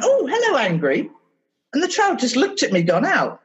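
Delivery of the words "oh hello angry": much higher mock-delighted hello; hammed-up surprise at meeting angry; playful greeting. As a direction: greet the feeling like a little creature. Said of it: "and went".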